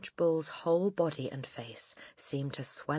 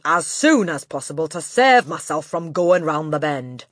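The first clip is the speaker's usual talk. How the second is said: loud, overbearing tirade; domineering headmistress, disgusted; 3 semitones higher, 11 dB louder than usual. A narrator's reading. Headmistress berating her new pupils, loud and disgusted. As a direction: rail at them at full volume.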